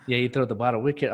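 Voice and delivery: ordinary talk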